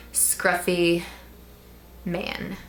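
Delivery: usual speaking voice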